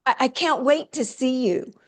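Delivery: high, eager gush; eager excitement. Shown as a demonstration. Guest showing anxious phrasing, gushing eagerly. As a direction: sound like you can't wait. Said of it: "example of eager phrasing".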